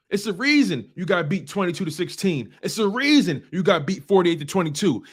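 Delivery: ordinary talk, nothing marked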